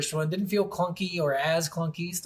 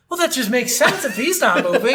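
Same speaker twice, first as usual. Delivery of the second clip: laughing disbelief; 7 semitones higher, 6 dB louder than usual. Retold or imitated. Retelling confused thoughts while gaming.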